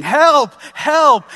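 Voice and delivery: frantic high shout of "Help!"; screaming for help. Retold or imitated. Panicked father calling for help in the hallway, screaming. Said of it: "I literally start screaming".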